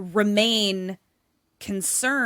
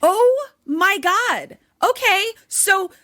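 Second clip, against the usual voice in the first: high, loud, drawn-out exclamation; appalled; 10 semitones higher, 5 dB louder, slower than usual. Real, not acted. Co-host reacting to the slap and fall, appalled. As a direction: cry out in exasperated shock.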